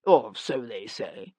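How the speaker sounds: lightly voiced mouthful; talking through food. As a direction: mouth full, slow. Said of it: "spoke around it".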